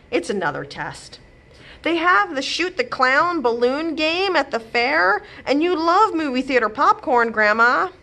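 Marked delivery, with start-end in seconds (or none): 1.8-7.9 put-on higher, eager kid begging; pleading child. A narrator's reading. Grandchild begs grandma for the fair, pleading kid voice. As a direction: beg sweetly like a little kid.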